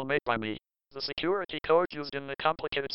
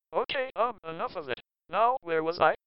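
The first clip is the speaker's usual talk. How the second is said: normal talking voice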